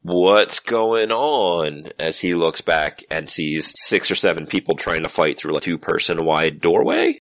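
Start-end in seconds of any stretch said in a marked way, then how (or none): 0.0-1.9 very slow, drawn-out higher call; startled security guard. Acted. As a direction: stretch it out as a puzzled guard. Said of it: "security guard ... is like".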